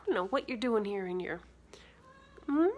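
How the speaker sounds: normal talking voice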